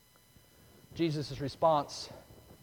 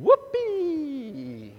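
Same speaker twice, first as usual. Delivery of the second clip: high, loud joyful whoop; gleeful whoop; 10 semitones higher, 6 dB louder than usual. Real, not acted. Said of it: "That's me".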